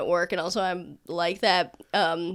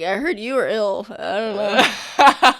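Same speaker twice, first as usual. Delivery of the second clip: flustered laugh; 6 dB louder than usual. Retold or imitated. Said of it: "I was like".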